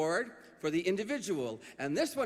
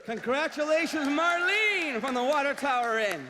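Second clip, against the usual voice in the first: announcer voice; 5 semitones higher, 3 dB louder than usual. Acted. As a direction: announce her proudly. Host congratulates winner.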